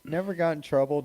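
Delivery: usual speaking voice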